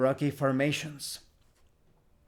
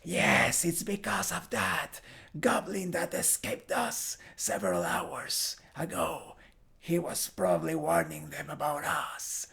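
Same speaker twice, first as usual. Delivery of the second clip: soft, whispery character voice; game character half-whispering to companions; Jelit; 6 dB quieter than usual. Acted. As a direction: speak in a hushed, airy put-on voice. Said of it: "Jelit speaks".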